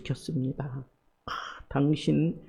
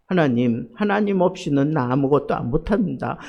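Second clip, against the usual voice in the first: ordinary talk, nothing marked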